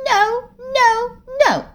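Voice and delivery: put-on high, tearful little-girl no; a scared child refusing firmly, close to crying; firm refusal. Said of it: "firmly said".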